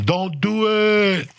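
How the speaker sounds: slow, high, drawn-out warning; stretched mock yell, pitched high. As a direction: call it out to the whole line. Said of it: "So I'd be yelling at them".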